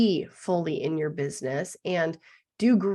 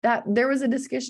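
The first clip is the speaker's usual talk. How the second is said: usual speaking voice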